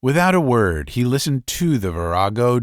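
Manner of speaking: ordinary delivery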